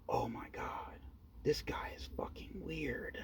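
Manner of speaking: slow, hushed whisper of disbelief; whispering gossip. Retold or imitated. Girls in line whispering about the man with the doll. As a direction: whisper it behind your hand. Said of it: "start whispering and laughing".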